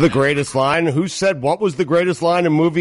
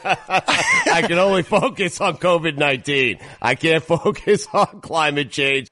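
amused, laughing as you agree. Real, not acted. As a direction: talk through real laughter. Amused agreement after colleague's rant.

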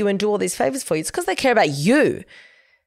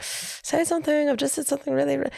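needy insulter; 8 semitones higher, 5 dB quieter than usual. Retold or imitated. The insulter begs her to answer, imitated higher and softer. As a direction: mimic someone desperate for a comeback.

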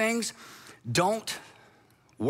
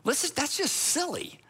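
higher, impatient and dismissive; an outsider's impatient advice, mildly acted; exasperated outsider; 6 semitones higher than usual. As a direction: brush off their feud as silly. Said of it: "you think to yourself".

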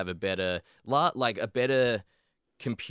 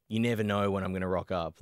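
normal talking voice

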